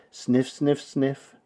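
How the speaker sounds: ordinary delivery, nothing marked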